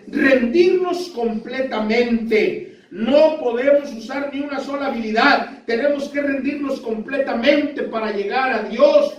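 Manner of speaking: slow, emphatic, high pulpit exhortation; fervent preaching. Real, not acted. Preacher urges the congregation to surrender to God, slow fervent preaching. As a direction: hammer out each phrase, drawing out the key words.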